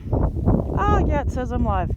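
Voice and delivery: bright, high surprised "oh, yeah"; sudden happy surprise; excited. Real, not acted. Notices stream is live.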